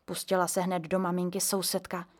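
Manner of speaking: usual speaking voice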